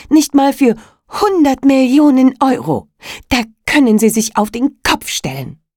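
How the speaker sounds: loud, grim refusal; defiant. A narrator's reading. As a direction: glare back and refuse. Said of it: "so finster sie konnte". (finster und trotzig)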